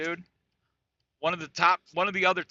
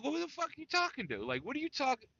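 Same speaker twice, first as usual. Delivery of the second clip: baffled; 10 semitones higher, 4 dB quieter, faster than usual. Retold or imitated. Son baffled by mom.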